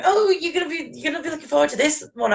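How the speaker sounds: high, gushing imitation of eager friends; mock eagerness. Fan quoting friends about the guest announcement, mock eagerness. Mimics others' eager anticipation.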